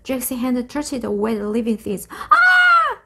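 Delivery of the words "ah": sudden very high, loud yelp; frightened cry. A narrator's reading. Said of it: "he cried".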